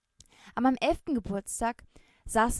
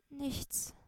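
much softer, slightly higher murmur; 5 semitones higher, 10 dB quieter than usual. Acted. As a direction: mumble it away, hiding the truth. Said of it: "murmelte ich".

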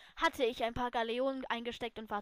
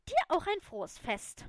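higher-pitched, huffy retort; pointed, tart; 5 semitones higher, 4 dB louder than usual. A narrator's reading. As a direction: say it pointedly, piqued. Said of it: "meinte sie spitz".

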